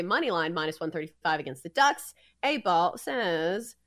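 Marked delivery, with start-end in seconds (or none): none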